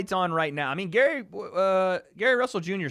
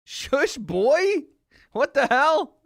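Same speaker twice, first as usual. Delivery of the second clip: high squeaky voice; 10 semitones higher, 7 dB louder, much slower than usual. Pretend. Still in high voice.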